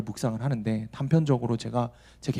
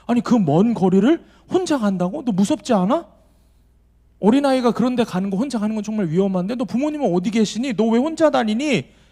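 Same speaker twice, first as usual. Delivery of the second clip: alarmed questioning; 6 semitones higher than usual. Retold or imitated. Pastor to a lone child on the train, higher and alarmed. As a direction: ask in rising disbelief, slowing at the end.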